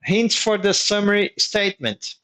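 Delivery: normal talking voice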